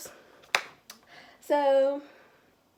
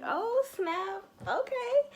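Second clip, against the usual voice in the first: high, squealing oh snap; a giddy high-pitched oh snap; giddy excitement; 11 semitones higher, much slower than usual. Retold or imitated. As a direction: squeak with delight. Excited entering first club.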